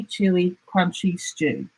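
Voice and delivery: plain reading voice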